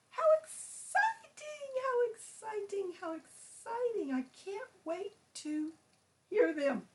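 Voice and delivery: gasping, thrilled bird, pitch leaping high; excitable bird character. Acted. Bird gushing to teacher about students' poems, thrilled. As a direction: squeal with stretched-out anticipation.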